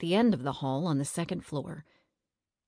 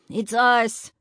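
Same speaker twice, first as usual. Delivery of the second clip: higher, louder call up to the window; yelling; 6 semitones higher, 7 dB louder than usual. A narrator's reading. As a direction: shout up to the old woman upstairs. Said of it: "Eddie yelled".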